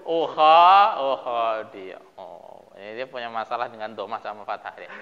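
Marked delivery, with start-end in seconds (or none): none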